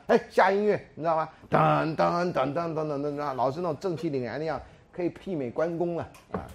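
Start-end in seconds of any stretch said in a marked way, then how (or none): 1.5-3.3 slow mock fanfare, sung in syllables; dramatic music imitated by mouth; hummed fanfare. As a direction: hum the hero's entrance music. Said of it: "就这时候应该有音乐下来呢".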